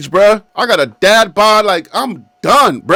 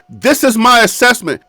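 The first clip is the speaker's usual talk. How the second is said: ordinary talk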